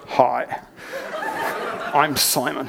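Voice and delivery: mock deep late-night radio host greeting. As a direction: drop down into a deep radio presenter. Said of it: "He's got the deepest voice ever".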